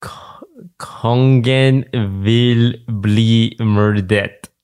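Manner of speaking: kongen vil bli myrdet said very slowly, word by word; slow repeat. Shown as a demonstration. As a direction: spell out kongen vil bli myrdet slowly for someone who didn't catch it. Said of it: "can you say the last sentence again?".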